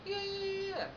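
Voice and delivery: high, sustained run of yeahs; eager caller. Retold or imitated. Client confirming the booking, high-pitched eager agreement.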